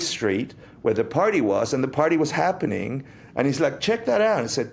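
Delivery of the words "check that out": much higher, eager call to look; excited pointing out. Retold or imitated. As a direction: spot it and point it out, thrilled. Friend spots the bookstore sign.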